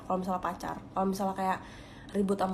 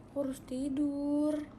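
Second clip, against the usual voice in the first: "Aku harus tidur" said slow and higher, drawn out; sleepy announcement; 7 semitones higher, slower than usual. Real, not acted. Telling viewers it is midnight, slow and higher. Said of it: "udah jam dua belas".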